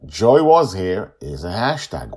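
ordinary delivery

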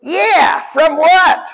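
high dumb robot echo; high baffled robot sidekick; Grounder's voice. Retold or imitated. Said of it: "Grounder goes".

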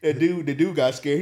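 usual speaking voice

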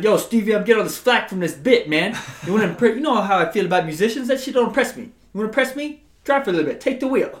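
loud, fast-talking comedian impression; Eddie Murphy. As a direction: rattle it off loud like a stand-up bit. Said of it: "I like Eddie Murphy's fucking".

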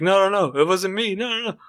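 high, frantic begging; pleading maid. Acted. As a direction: plead your innocence up high.

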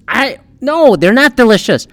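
usual speaking voice

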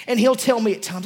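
ordinary delivery

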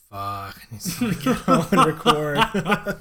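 high, loud laughing quote; laughing exasperation. Retold or imitated. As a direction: laugh through the complaint. Retold bus frustration, laughing.

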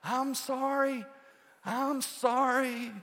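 high, slow called-out apology; distant apology call. Retold or imitated. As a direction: call sorry from far off. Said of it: "I hear somebody".

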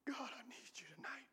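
soft, breathy pleading whisper; whispered prayer. Acted. Preacher leading the congregation in a plea to God, whispered. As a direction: pray it under your breath.

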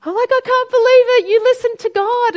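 high, loud and fast, overwhelmed with joy; reliving the tearful rush of joy at the funeral; tearful excitement. Retold or imitated. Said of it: "I'm all tears and crying".

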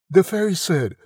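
plain reading voice

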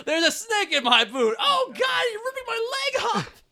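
high, loud, laughing cowboy toy voice; laughing Woody impression. Joking that Woody loses a leg, hammed-up yelp. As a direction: toy cowboy yelping as his leg comes off.

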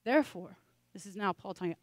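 ordinary delivery

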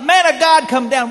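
loud and high, shouting up a hill; commanding shout. Acted. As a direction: bark the order up the hill. Captain shouting up to Elijah.

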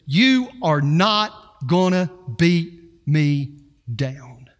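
slow, low, firm resolve; quiet defiance. Retold or imitated. Preacher voicing the church's resolve, slow low defiance. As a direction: dig in and mean every word. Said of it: "an attitude that says".

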